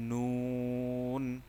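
নুন drawn out very long with rounded lips; nun demo. Shown as a demonstration. Teacher shows rounded-lip নুন to students, slowly held. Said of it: "ঠোঁট automatic গোল হয়ে যাবে".

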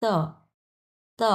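ত held with a neutral "uh" vowel, "tuh"; Chinese-style t. Shown as a demonstration. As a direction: say ত as a drawn-out Chinese "de", not Bangla tô. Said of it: "আমরা এইভাবে বলবো হচ্ছে".

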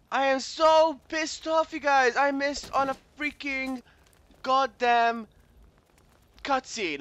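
high, slow, loud exasperation; really pissed off. Real, not acted. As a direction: stretch each word out in frustration.

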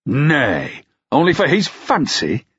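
high outraged question; outrage. A narrator's reading. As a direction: protest in disbelief, pitch leaping up. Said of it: "Ruck asked in outrage".

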